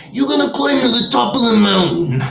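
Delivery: high, strained wrestler voice; hammed-up pro wrestler challenge; Hulk Hogan. Imitating a wrestler for laughs, hammed-up impression. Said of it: "that was more of a Hulk Hogan".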